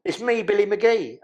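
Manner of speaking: lightly voiced inmate at the door; Billy's voice again. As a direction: repeat Billy's line, slightly calmer. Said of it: "I've got to say it because it don't make no sense".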